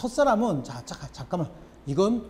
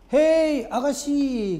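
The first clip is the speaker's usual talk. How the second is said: much higher and louder bird call; 9 semitones higher, 6 dB louder, much slower than usual. Retold or imitated. The bird, unable to hold back, calls out loudly to the woman. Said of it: "못 참겠다는 듯이 불렀어요".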